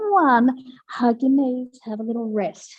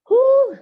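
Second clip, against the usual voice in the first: whoo of effort; 13 semitones higher, 7 dB louder than usual. Real, not acted. Exhale after hard set.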